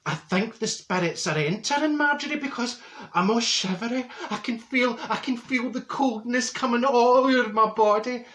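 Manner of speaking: lightly voiced medium feeling the spirits; mock psychic trance.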